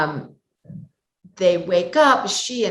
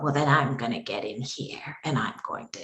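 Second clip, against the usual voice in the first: small, low, bitey witch voice; demonstrating a soft, lower old-witch voice; 6 semitones lower, 5 dB quieter than usual. Shown as a demonstration. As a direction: shrink the voice down low and quiet, with bite. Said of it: "if it's an old witch ... make my voice smaller and lower".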